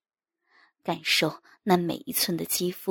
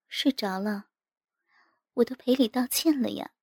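lightly voiced, teasing wife; gently coaxing wife; 4 semitones higher than usual. A narrator's reading. Wife coaxing her sulking husband in bed, gently teasing. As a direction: coax a sulker with playful little questions. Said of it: "在我耳边轻轻地说".

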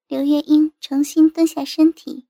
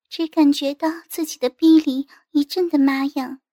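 ordinary delivery

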